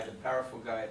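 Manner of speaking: ordinary talk, nothing marked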